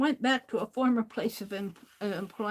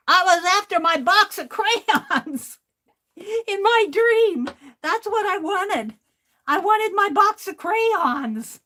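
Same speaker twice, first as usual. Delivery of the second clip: higher, louder, laughing through the crayon punchline; giggly confession; 8 semitones higher, 7 dB louder than usual. Real, not acted. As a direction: burst with amused delight. Laughing confession about dream crayons.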